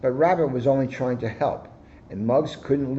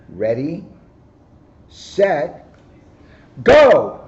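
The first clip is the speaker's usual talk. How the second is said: from plain to a high, loud GO; building to a cry; 3 semitones higher, 9 dB louder, much slower than usual. A narrator's reading. Rabbit starts the race, building to a loud cry. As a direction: count down and shout the start. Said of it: "she cried".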